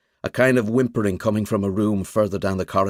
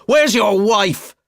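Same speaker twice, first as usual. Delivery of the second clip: demanding; 13 semitones higher, 7 dB louder, much slower than usual. Acted. Finn forcing into the house.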